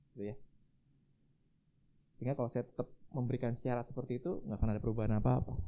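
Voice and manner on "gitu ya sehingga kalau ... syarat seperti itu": ordinary talk, nothing marked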